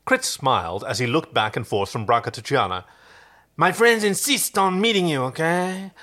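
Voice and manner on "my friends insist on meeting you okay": put-on high, accented Muppet creature voice; king prawn. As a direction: play a cartoonish prawn puppet, high-pitched. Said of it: "go for the king prawn from Muppets in Space".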